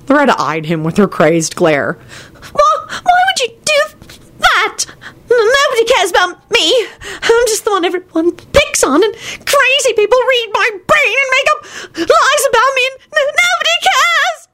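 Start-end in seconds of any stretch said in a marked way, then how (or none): 2.5-14.5 very high, stammering hammed-up sobbing; bawling. A narrator's reading. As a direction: bawl it out in self-pity. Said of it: "she began bawling".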